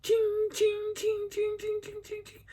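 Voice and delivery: high metallic chings, dying away; string of high, ringing chings; repeated chain chings. Retold or imitated. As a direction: mimic chains ringing back and forth. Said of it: "he's going back and forth".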